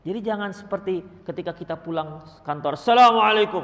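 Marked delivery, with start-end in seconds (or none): 2.8-3.6 loud, stiff greeting at the door; curt greeting. Acted. Father entering the house, loud and stiff. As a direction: bark the salaam as you walk in. Father coming home sternly.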